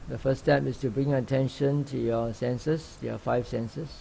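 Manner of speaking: calm, even meditation guide with long pauses; unhurried instructions, leaving long silences between phrases; guided meditation. Real, not acted. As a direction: gently guide sitters into open awareness. Leads a sitting meditation.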